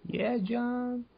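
soft, high-pitched put-on voice; playful high voice. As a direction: go up high and quiet to join in. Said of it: "Let's not keep going up".

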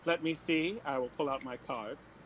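normal talking voice

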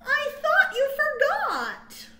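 high, loud, slow hamster voice; touched little hamster. Acted. Hamster telling his friends at the party, high and touched. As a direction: squeak it out, overjoyed and moved.

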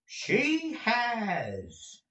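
high, drawn-out affirmation; long, high, falling assurance; emphatic cry. A narrator's reading. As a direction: cry it out, stretching both words. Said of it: "cried Newman".

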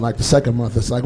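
usual speaking voice

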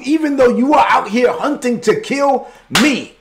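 impassioned preaching as David. Acted. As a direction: plead your innocence, rising in pitch. Preacher voicing David's plea to Saul.

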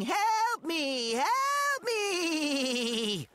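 long, high wailed cry for help; mock scream. Forgotten friend denies screaming up the tree, mock screaming. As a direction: mimic a panicked scream, stretching each plea. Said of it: "and I wasn't screaming".

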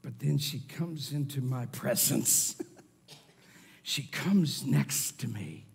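low, soft, fading to a whisper; hushed storytelling. Shown as a demonstration. Closeness allows hushed voice.

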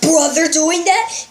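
ordinary delivery

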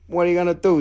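usual speaking voice

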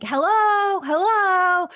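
high, loud hello called up the stairs; mock shout of a visitor arriving unannounced; calling hello from downstairs. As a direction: call out to the house from below. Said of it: "somebody shouting from downstairs".

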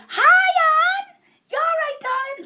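high, loud excited greeting; excited shout. Real, not acted. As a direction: squeal a delighted hello.

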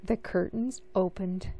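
usual speaking voice

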